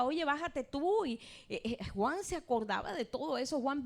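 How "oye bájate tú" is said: lightly voiced taunt, high and slow; a hint of a mocking bystander; mock jeering crowd. Crowd jeering at Jesus on the cross, mildly mocked. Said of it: "le gritaba".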